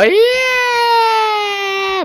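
long high cheer. Real, not acted. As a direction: hold a joyful yell. Game celebration.

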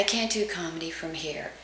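flat, soft low-energy voice. Shown as a demonstration. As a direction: show the deflated state.

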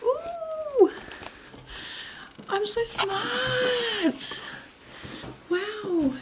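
very high, slow, drawn-out delight; excited. Real, not acted. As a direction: sing it out pleased with yourself. Delighted with own idea.

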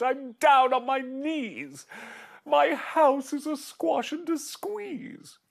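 put-on high, slow distressed old woman; old lady crying out. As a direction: wail it like a frazzled old lady. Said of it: "The little old lady cried".

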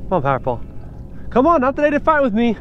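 ordinary delivery

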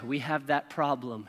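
low, slow, soft announcement; brain speaking up. Acted. Brain to someone going back to sleep, low and ominous. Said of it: "and your brain goes".

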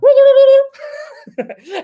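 high, loud turkey gobbling; turkey gobble. Pretend. Streamer joking about a room full of turkeys, gobbling. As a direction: burst into a mock turkey gobble. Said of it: "a room full of gobbling turkeys".

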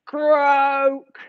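loud, drawn-out monotone croak; moaning toadfish croak. Acted. Toadfish greeting the dolphin on the seabed, moaning croak. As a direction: croak it out as one long moan. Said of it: "moaned the toadfish".

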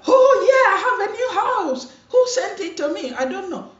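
mock naive surprise. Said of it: "And you're like".

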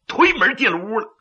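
plain reading voice

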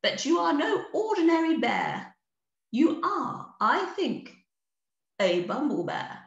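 high, stately queen; queen bee addressing the bear kindly; Queen's voice. Acted. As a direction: speak as a gracious bee queen. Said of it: "said the Queen".

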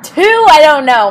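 exasperated. Real, not acted. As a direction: complain loudly in disbelief. Frustrated at unknown clues.

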